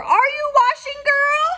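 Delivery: very high, loud witch call through a door. Acted. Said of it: "called through the door".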